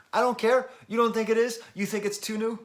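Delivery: high, loud challenge to doubters; mock defiance. Vlogger arguing with imagined viewers who disagree, loud mock defiance.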